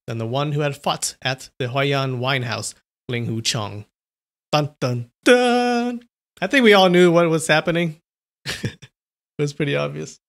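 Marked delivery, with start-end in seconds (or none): 4.5-6.1 rising mock fanfare; dramatic dun dun dun. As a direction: hum the reveal sting. Dramatic reveal sting.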